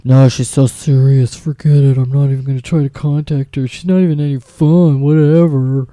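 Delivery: a hint of a scoffing man; dismissive guy. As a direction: brush her off like a bored guy.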